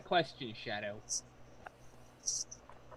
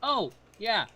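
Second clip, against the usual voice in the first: ordinary talk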